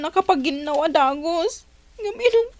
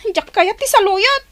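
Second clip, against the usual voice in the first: annoyed protest; 8 dB louder than usual. Acted. Refusing the food.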